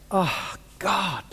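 soft, reverent address to God; hushed prayer. Acted. Habakkuk looking back and praying to God, hushed. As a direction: breathe it quietly, as a prayer.

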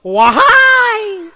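rising infant cry, loud and high; French baby cry. Retold or imitated. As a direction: squeal it like a newborn, pitch climbing. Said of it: "inflect from a low to a high pitch".

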